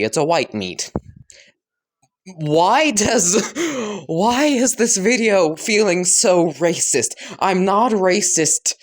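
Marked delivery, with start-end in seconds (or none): 2.4-8.7 high, half-laughing dismay; flustered protest. Real, not acted. Speaker protests accidental racist sound.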